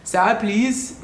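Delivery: tentative, put-on South African accent; attempted South African accent. Shown as a demonstration. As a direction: try a South African "Sir, please". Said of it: "let me see, can I do the accent?".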